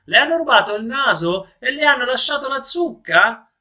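high, teasing jeer; mocking crowd. Laughing townspeople below tease the noseless man, jeering high. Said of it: "la gente guardava in su e rideva".

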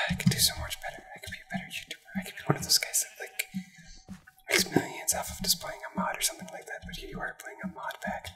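unvoiced self-mocking whisper. Real, not acted. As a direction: whisper it to yourself, defeated. Self-deprecating aside whispered.

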